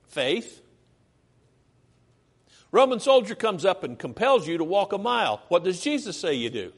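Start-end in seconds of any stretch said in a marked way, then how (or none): none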